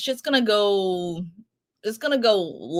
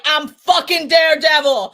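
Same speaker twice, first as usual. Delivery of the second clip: retold shout; 5 semitones higher, 9 dB louder than usual. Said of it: "he pulled up and was like".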